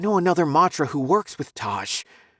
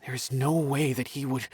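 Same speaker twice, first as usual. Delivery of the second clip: softer, trailing-off disbelief; hushed, troubled denial; wavering denial; 3 semitones lower, 6 dB quieter than usual. Acted. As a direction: let the protest fade away quietly. Doubting friend, trails into silence.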